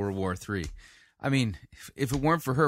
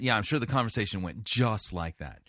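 ordinary talk

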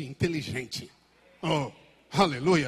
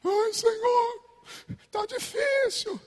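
much higher, slow whining plea; whiny believer; slower than usual. Pretend. Complaining believer whines to God in a high voice. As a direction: whimper like a self-pitying child. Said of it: "A Bíblia diz que Deus ri".

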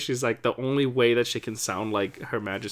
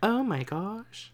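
1 mock Oprah gasp; 8 semitones higher, 5 dB quieter, slower than usual. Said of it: "Oprah just going".

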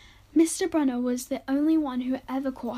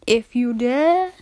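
slow, louder, dramatic spooky tease; spooky dare; 7 dB louder, slower than usual. Acted. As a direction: dare them like a campfire storyteller. Spooky sign-off to listeners.

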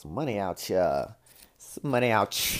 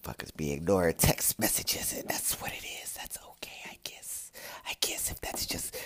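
muttered, softer whisper; 3 semitones lower, 7 dB quieter than usual. Real, not acted. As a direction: whisper it like a private grumble to yourself. Grumbling about ignored texts.

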